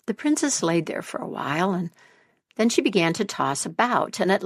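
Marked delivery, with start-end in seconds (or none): none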